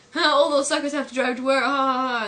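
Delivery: hammed-up gloat; mock gloating. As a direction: crow over the poor suckers. Homer gloats at traffic report.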